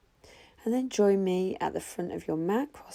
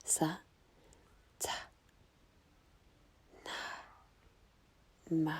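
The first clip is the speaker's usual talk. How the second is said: whispered mantra; 7 dB quieter than usual. Shown as a demonstration. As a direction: whisper each syllable softly on the out-breath. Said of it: "and whisper".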